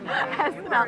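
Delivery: laughing through the letters S N L, slowly; a real laugh carrying the words. Real, not acted. Host laughing at the guest's comedy reference, amused.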